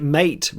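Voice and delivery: "Mate" said like English mate; first name version. Shown as a demonstration. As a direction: say "Mate" as one syllable rhyming with late. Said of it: "three versions of his name already".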